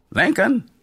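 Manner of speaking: bright, breezy railroad conductor voice; a jovial conductor shrugging it off; cheerful old conductor. A narrator's reading. Conductor announces stop.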